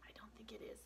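soft whispered doubt. Real, not acted. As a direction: whisper it gently. Hushed aside.

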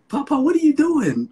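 puzzled grandchild. Retold or imitated. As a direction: play the bewildered kid watching grandpa. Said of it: "And I'm like".

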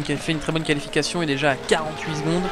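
ordinary talk

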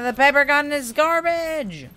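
loud, high complaint; frustrated wail. Real, not acted. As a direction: wail about the useless gun. Complaining loudly about the gun.

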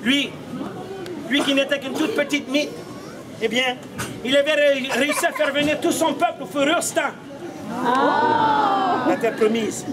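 usual speaking voice

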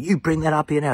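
high, loud bossy order; aggressive demand. Retold or imitated. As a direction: inmates bullying a man to bring his guitar. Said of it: "they started abusing him".

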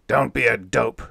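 loud gruff rebuke; captain roaring at the radioman. A narrator's reading. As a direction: roar at him. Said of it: "roared the old man".

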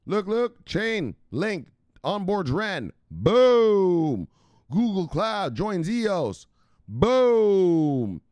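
high, loud, slow hype shouts; high, loud hype-man booms; hype announcing. Real, not acted. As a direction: bellow each boom like a ringside announcer. Hyped crypto news shoutouts.

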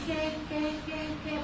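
"كا" said softly with a light front k; quiet, easy "كا", vowel kept thin; gentle, light "كا". Shown as a demonstration. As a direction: say "كا" gently, without any heaviness. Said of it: "بالراحة".

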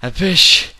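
high, loud put-on voice; goofy high call; silly high voice. Mock challenge voice.

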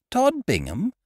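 name tossed back, pitch climbing high; incredulous echo. Acted. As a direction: Tod Bingham? asked in amazement.

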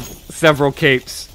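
normal talking voice

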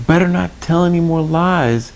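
high-pitched little cricket voice; high storybook voice, gently scolding; Jiminy Cricket. Acted. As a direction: warn in a high, small character voice.

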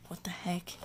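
breathless whisper of confusion; whispered what the heck. Real, not acted. Puzzled by the card odds, he whispers to himself. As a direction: whisper it to yourself, baffled.

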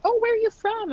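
high, loud, bubbly question; chirpy curious caller. Retold or imitated. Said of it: "people from the States being like".

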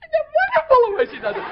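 laughing hard and high. Real, not acted. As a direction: break up laughing while praising her. Host laughing at her delivery.

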